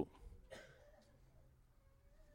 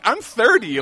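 high and loud through a laugh; 11 semitones higher, 12 dB louder, slower than usual. Real, not acted. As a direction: crack up on the reply. Retelling fan mix-up, laughing.

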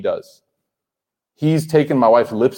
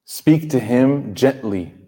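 slow emphatic reading; much slower than usual. Shown as a demonstration. Slow emphatic rendering of verse.

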